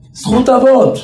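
high, ringing retold reply; rabbi's emphatic answer. Rabbi repeating his answer to followers, emphatic. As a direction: call the phrase out well above normal pitch. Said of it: "ככה סידנא היה עונה".

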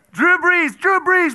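high, loud stadium shout; fan cheer. Acted. Preacher showing how he cheers for the quarterback. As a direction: cheer his name like a fan.

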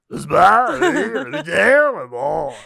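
loud, high pompous orator; Jean-Marie Le Pen impression. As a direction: declaim like an old politician. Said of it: "Pourquoi tu imites ... Jean-Marie Le Pen".

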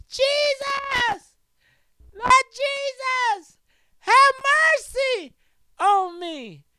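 high, loud desperate plea; blind man crying out. Acted. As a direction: cry out to Jesus for mercy, drawn out. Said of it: "He cried out the more".

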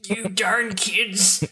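high, drawn-out cartoon scolding; mock MLB. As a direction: scold the youngsters in a slow, high caricature. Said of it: "accurate depiction of the M L B".

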